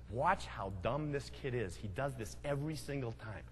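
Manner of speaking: put-on older brother muttering to his buddies, low and smug; sly aside to friends. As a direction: let the friends in on the joke. Brother winks and confides to friends.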